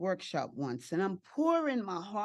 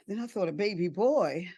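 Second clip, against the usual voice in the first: ordinary talk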